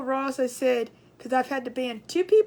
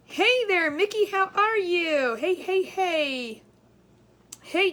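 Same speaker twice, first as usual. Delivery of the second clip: drawn-out slow greeting, higher and louder; sing-song greeting; 4 semitones higher, 3 dB louder, slower than usual. Real, not acted. Streamer greeting a viewer by name, slow and sing-song. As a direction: stretch the hello out to the viewer.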